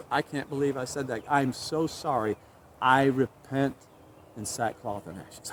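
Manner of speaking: soft, low, broken apology; remorseful Job. Acted. Preacher voicing Job ashamed of his words. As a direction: hush down into shame.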